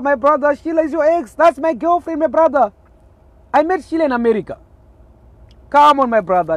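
loud and high hailing a friend; excited loud greeting. Real, not acted. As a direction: call out over the phone to a brother.

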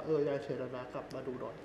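quietly passing on the death; quoted sad phone call. Retold or imitated. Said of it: "สวนเข้ามาว่า".

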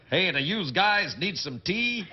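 slow, exaggerated Brit doing American; mock American accent drawled out slowly; bad American accent. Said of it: "whenever I hear it, it's like".

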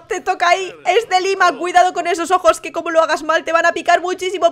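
ordinary delivery

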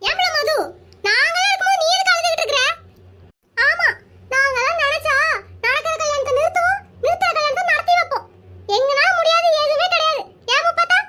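put-on a light touch of a high, laughing older woman; boastful village aunt. Aunt comforting Madhu with boastful promises, laughing. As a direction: brag to cheer the girl up, chuckling. Second aunt consoles with bravado.

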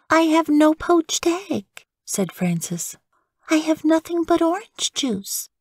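put-on high little girl's protest; childlike complaint. Frances protests to mother at breakfast, high and childlike. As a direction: whine it a bit.

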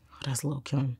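breathy mocking whisper of other people. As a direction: whisper it like gossip about a star. Mocking how people saw her.

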